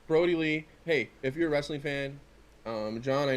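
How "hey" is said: normal talking voice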